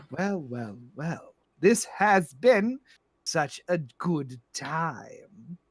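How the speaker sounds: slow, drawn-out grande dame; composed, sinister madam. Acted. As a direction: savour every word with cool amusement. Plays Madam Storybrooke.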